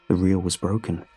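plain reading voice

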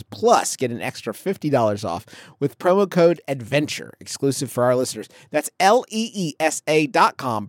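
low, steady sponsor read. Acted. Host reading the mattress ad to listeners, ad read voice. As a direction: sell the mattress like a radio spot.

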